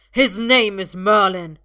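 very loud slow shout; loud slow defiant shout. A narrator's reading. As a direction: shout his name in defiance. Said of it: "Arthur shouts".

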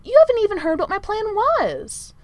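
high, loud, offended protest; indignant. A narrator's reading. Manservant defending his unheard plan to the prince, indignant. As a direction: object hotly to being dismissed. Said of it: "said Merlin indignantly".

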